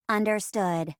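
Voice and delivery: even robot computer acknowledgement; robot assistant. Acted. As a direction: confirm the order like a ship's computer. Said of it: "I'm your robot assistant".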